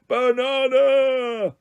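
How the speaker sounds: high, long-drawn roar; hungry gorilla calling out; giant gorilla roar. A narrator's reading. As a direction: stretch each vowel into a slow animal bellow. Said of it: "roared the giant gorilla".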